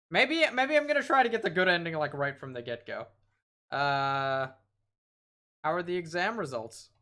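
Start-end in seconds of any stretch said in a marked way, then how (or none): 0.1-1.9 suddenly keen to win; eager. Real, not acted. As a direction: voice jumps up, eager and loud. Hopeful about getting good ending.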